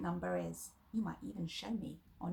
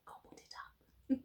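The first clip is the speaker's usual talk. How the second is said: secret playful whisper; 6 dB quieter than usual. Acted. Playful aside about eating apple.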